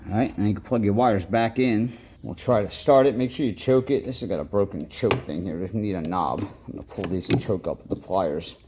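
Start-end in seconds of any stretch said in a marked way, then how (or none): none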